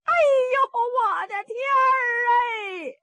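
high, keening theatrical cry; hammed-up tearful lament; wailing. A narrator's reading. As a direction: wail it out in long, high sobbing tones. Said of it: "这盖大奶奶哭上了".

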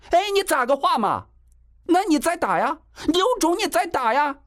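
very high-pitched angry challenge; enraged. A narrator's reading. Mother dares young man to hit again, enraged. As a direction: dare him furiously, voice right up. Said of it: "郑阳娇更是气不打一处来啊".

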